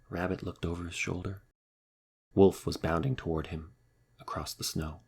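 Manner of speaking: usual speaking voice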